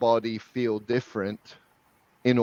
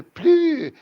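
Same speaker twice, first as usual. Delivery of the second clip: French accent, leaping very high and louder; exaggerated French sound; 6 dB louder than usual. Shown as a demonstration. Coach showing how to model French people, exaggerated French accent. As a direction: play with a big French vowel, drawn out high. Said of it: "to learn a French accent".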